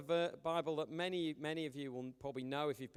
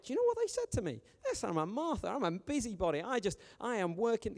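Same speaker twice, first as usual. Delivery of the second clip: high, hammed-up indignation; mock offended churchgoer; 6 semitones higher than usual. A churchgoer complaining they were called a Martha, mock outrage. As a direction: squeak it out like a wounded gossip. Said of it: "You'd be like".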